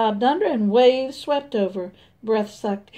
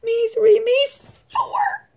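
very high, louder squealing chorus with a mock cry; pitched-up chicken voices, half crying; 13 semitones higher, 4 dB louder than usual. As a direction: shriek it like panicked hens. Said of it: "said the others".

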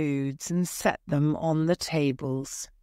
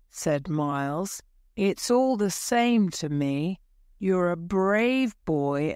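plain reading voice

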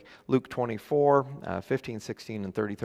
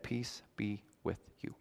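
much softer, hushed "Peace be with you"; different; 2 semitones lower, 13 dB quieter than usual. Shown as a demonstration. Jesus greets his locked-in followers, much softer. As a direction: drop right down and say it gently. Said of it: "That's different".

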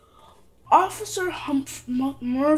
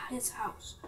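usual speaking voice

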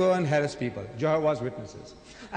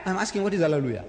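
normal talking voice

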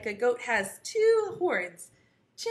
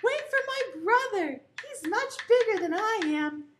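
high, pleading goat; middle goat; 9 semitones higher than usual. Acted. Middle goat begging troll to wait, high-pitched. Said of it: "the middle Billy Goat said".

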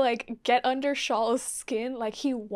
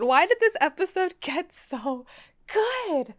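ordinary delivery

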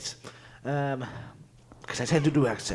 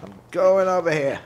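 higher, louder, eager call; a quick raised announcement; high excited call; 8 semitones higher, 6 dB louder than usual. Pretend. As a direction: sing it out brightly. Repeats line in two voices.

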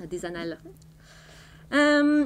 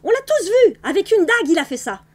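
much higher, much louder cry; triumphant shout; 7 semitones higher, 8 dB louder than usual. A narrator's reading. Granny standing up to accuse the actor, a triumphant high shout. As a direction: denounce the murderer in triumph. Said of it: "cria-t-elle d'une voix triomphante".

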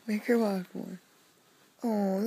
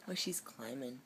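hushed whisper; 8 semitones lower, 13 dB quieter than usual. Real, not acted. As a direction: whisper so the dog stays calm. Unvoiced whisper.

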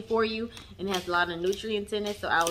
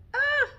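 overwhelmed squeal. Real, not acted. Overwhelmed by gifts.

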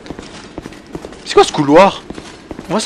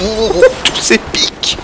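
awed ooh; much slower than usual. Real, not acted. Awed at spikes, drawn out.

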